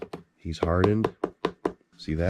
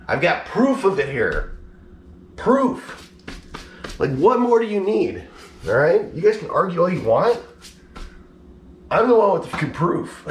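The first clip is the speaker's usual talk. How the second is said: much higher and louder, worked-up challenge; heated rant; 9 semitones higher, 3 dB louder than usual. Real, not acted. Telling doubting viewers he has proof, heated rant. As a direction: throw the proof in their faces.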